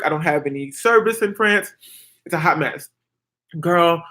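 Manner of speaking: ordinary talk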